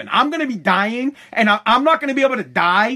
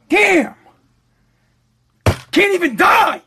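much higher, louder exasperated yell; mock outburst; 10 semitones higher, 6 dB louder, slower than usual. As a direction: throw up your hands and shout it. Comic outburst about dying.

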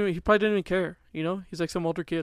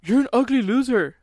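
put-on higher, louder kid's taunt yelled out a car window; retold yell; 8 semitones higher, 7 dB louder than usual. Kid yelling at a boy on a bike, a loud high taunt. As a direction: shout the insult at the passing kid. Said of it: "I yelled at this kid".

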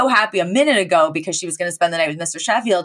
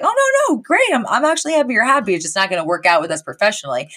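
breezy brush-off; 3 semitones higher, much faster than usual. Retold or imitated. Someone turning a friend down, breezy brush-off. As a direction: rattle off a polite brush-off. Acting an over-polite brush-off.

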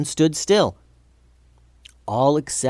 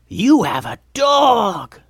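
high, stretched-out goblin excitement; thrilled Goblin King; 9 semitones higher, slower than usual. A narrator's reading. Steve noticing the dog, thrilled high goblin. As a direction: squeal it slowly as a delighted goblin.

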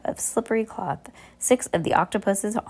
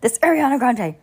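mock diva voice; 6 semitones higher, 5 dB louder than usual. Reader joking with listener, playful mock diva. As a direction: toss it off like a pop diva. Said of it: "Remember Ariana Grande, we were calling it".

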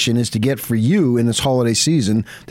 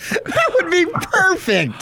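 delighted; slower than usual. Real, not acted. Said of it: "delighted reaction".

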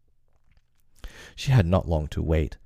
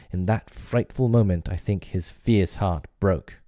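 usual speaking voice

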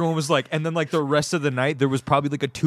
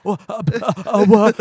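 put-on bewildered girl; 6 semitones higher, 5 dB louder than usual. Stunned girl at show.

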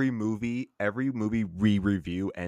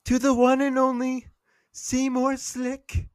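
much higher, loud announcer voice, slowing on the name; grand announcer; 7 dB louder than usual. Acted. Host dedicating the night to Seymour Slick, booming announcer voice. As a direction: proclaim it like a ringside announcer.

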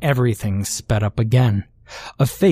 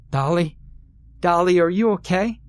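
higher-pitched, anxious question rising at the end; worried sister; 7 semitones higher than usual. A narrator's reading. Odessa checks on her rocking sister, worried. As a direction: ask it high and gently worried.

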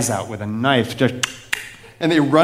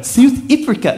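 attempted South African accent; 9 semitones higher, 3 dB louder than usual. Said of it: "I can't do a South African accent".

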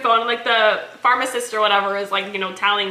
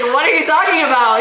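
raised, louder puzzled question; loudly retold confusion; mock bafflement; 6 semitones higher, 7 dB louder than usual. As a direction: act out a baffled husband. Retelling husband's baffled reply.

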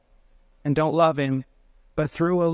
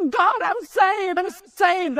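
high, slow, weeping cry of relief; joyful crying; 14 semitones higher, much slower than usual. Retold or imitated. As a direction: sob it out to God, overwhelmed. Dying man cries out in joy.

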